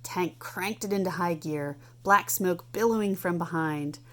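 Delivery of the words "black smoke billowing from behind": plain reading voice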